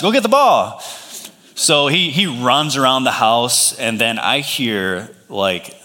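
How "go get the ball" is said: higher-pitched dad calling an order; playful fatherly command, pitched up. Retold or imitated. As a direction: send the kid off with a raised voice. Dad sends son after ball.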